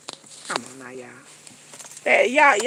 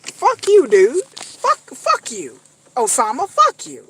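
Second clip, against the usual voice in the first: angry outburst; slower than usual. Real, not acted. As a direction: spit it at him. Outburst at Osama.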